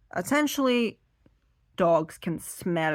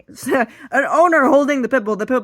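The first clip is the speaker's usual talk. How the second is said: laughing; 6 semitones higher, 12 dB louder than usual. Real, not acted.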